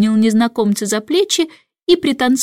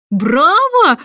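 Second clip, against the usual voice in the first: higher, louder cheer; bravo shout; 7 semitones higher, 4 dB louder than usual. A narrator's reading. Jester cheers the king's song, loud. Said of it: "вскричал шут".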